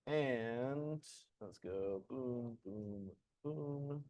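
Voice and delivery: very soft, absent-minded murmur; barely audible, to yourself; muttering to self. Real, not acted. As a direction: talk under your breath as you work. Muttering to self while working on screen.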